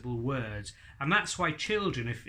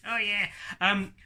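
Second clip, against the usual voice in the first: puppet voice; 7 semitones higher, 4 dB louder than usual. Acted. Puppet Hadley answers.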